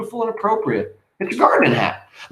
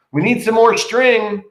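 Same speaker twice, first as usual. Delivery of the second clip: higher, louder call from another room, slow; 9 semitones higher, 4 dB louder, slower than usual. Acted. Benita calling from her room.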